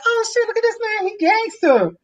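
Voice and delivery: hammed-up high voice of an amused cashier; retelling a cashier laughing at his knife; imitating the cashier lady. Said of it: "She's like".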